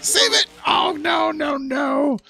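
high panicked shout. Real, not acted. Truck flipping, yelling.

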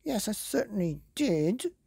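high, indignant little wolf; puffed up. Acted. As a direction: puff yourself up and insist. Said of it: "puffing himself up".

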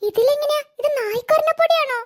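put-on high, worried kid voice; a little girl asking anxiously; child voice. Child asks about the itching powder.

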